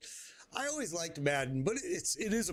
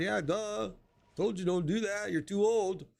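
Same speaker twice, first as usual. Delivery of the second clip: higher-pitched mocking I-told-you-so; imitated scolding; 7 semitones higher than usual. Wife to husband in pain next day, imitated scolding. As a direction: scold him like an exasperated wife.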